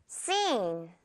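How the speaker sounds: "Scene" with a long, stretched ee; soft, slow SEEN for "Scene", the c silent; long vowel. Shown as a demonstration. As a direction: draw out the ee of "Scene" softly, falling. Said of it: "길게 늘어뜨려서".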